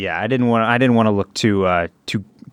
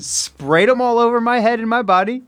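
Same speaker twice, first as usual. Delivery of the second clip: bawdy innuendo; 12 semitones higher than usual. Acted. Bawdy lube story.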